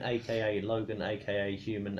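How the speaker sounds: ordinary talk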